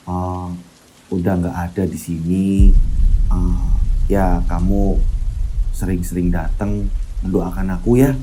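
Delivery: put-on low, slow, subdued boy who sounds doomed; suara Bayu lirih. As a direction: speak low and slow, like a boy saying goodbye. Bayu's voice in the story. (suara anak lirih dan pelan, seperti berpamitan)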